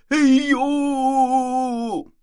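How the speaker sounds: 又高又响的长声惊叫; 尖声拖长喊出“哎呦”; 拖长声音大叫. A narrator's reading. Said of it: "拖长了声音大叫了一声". (拉长嗓音大叫一声)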